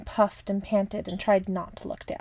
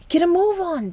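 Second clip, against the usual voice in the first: louder, much higher urgent shout; 10 semitones higher, 6 dB louder than usual. A narrator's reading. Button shouting to Uncle Noggin and the captain, loud and high. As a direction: call them to hurry across the room. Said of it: "Button shouted".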